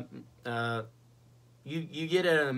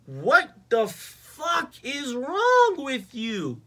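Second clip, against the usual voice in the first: drawn-out, high, loud exasperation; outraged disbelief; 11 semitones higher, 6 dB louder, much slower than usual. Acted. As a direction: stretch every word in appalled outrage. Outraged reaction to the kiss scene.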